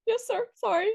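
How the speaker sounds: high, meek apology to the boss; meek yes sir. Retold or imitated. Young DJ answering her boss, meek and high. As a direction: play the chastened young DJ, pitched well up.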